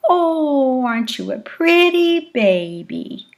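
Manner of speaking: infant-directed speech. Shown as a demonstration. Adult talking to an infant, exaggerated high melodic baby talk. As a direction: coo it to a baby with big swooping pitch. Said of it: "infant-directed speech by adults".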